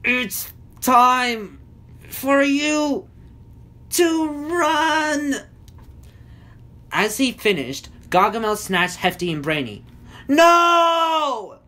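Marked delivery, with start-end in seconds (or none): 0.0-5.4 high, strained, halting words; strained, word-by-word effort; through frozen lips. A narrator's reading. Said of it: "Hefty mumbled through frozen lips". 10.3-11.6 long, high, loud cry; drawn-out high-pitched scream; shriek. A narrator's reading. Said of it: "Smurfette shrieked".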